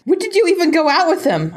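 high-pitched acted questioning; mock indignation. Reader voicing a freaked-out girlfriend, high indignant question.

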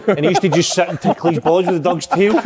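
real giggles pushing the voice up; cracking up. Real, not acted. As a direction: repeat it while breaking into laughter. Repeats the line, cracking up.

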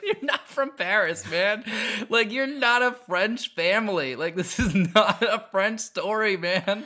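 high, slow, exaggerated disbelief; mock a movie character with a put-on voice, stretching it out; exasperated mock protest. Mocking a foreign-accented film character.

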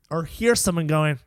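normal talking voice

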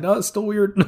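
high, laughing mock Strange; laughing Strange quote. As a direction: giggle through the line as Strange.

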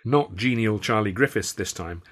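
ordinary delivery

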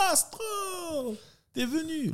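high, drawn-out call to a friend; surprised calling out. Retold or imitated. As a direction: imitate someone hailing a pal in surprise. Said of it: "il y a un gars qui sort, que je connais". (appel aigu et étonné)

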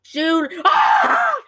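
high, loud mock scream of Julie's name; calling out Julie. Nadine calling for Julie before the bear attacks, loud and high. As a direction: yell for your friend then shriek. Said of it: "Nadine is like".

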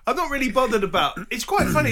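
laughing. Real, not acted. About trolls.

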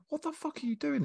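very high, squeaky retold disbelief; incredulous protest. Customer retelling his protest at post office charges, incredulous. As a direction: act out a baffled complaint, voice pitched right up.

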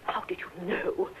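slow, unvoiced whisper of amazement; amazed whisper. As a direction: breathe it out slowly, astonished. Astonished widow whispers as safe opens.

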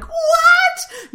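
high, loud disbelieving shriek; shocked shout. Retold or imitated. To the host, retelling her shocked reaction to the guest. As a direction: yelp it like you can't believe it. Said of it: "I was literally like".

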